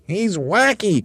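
very high, loud squawky cry; Roly-Poly Bird crying out. A narrator's reading. Bird about monkey leader, crying out. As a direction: squeal it like an excited bird. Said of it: "cried the roly-poly bird".

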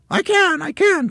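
very high, loud excited squeal; Missus Twit. A narrator's reading. Wife feeling the balloons pull, high loud cry. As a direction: squeal it out urgently. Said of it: "cried Missus Twit".

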